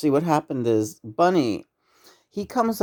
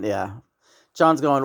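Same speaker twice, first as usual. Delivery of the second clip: ordinary talk, nothing marked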